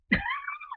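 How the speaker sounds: short high laugh at herself; burst of laughter. Real, not acted. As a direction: laugh out, amused at your own tears.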